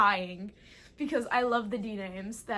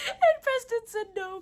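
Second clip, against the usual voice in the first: high, loud giggly telling; laughing out the line; 12 semitones higher, 9 dB louder than usual. Real, not acted. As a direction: giggle through it.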